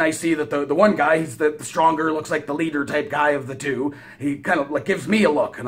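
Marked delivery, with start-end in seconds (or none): none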